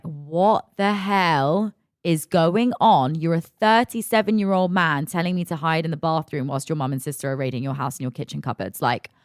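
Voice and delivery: ordinary delivery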